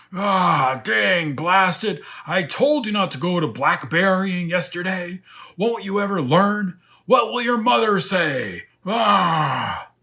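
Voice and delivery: loud, high furious roar at himself; angry lion roaring. Acted. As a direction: yell at yourself in a rage, high and loud.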